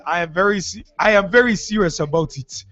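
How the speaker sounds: put-on deliberate, earnest Ghanaian-accented delivery. Host quoting Ghanaian spiritualist on radio, put-on accent. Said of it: "Where did the accent come from?".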